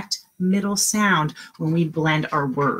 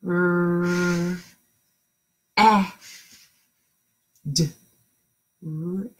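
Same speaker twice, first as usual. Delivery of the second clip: slow sounding out; much slower than usual. Shown as a demonstration. Teacher sounding out red slowly for the children. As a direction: slowly split R, eh, d, then blend into red. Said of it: "I wanna hear you".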